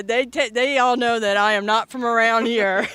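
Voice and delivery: loud laughing admission. Real, not acted. Laughing about not being local.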